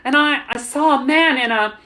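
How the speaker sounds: urgent report. A narrator's reading. Servant girl tells young master what she saw, urgent. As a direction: stumble urgently through what you witnessed.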